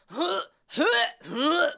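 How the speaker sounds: high, loud mock gag noises; imitated gagging. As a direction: act out retching huffs. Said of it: "he would be like".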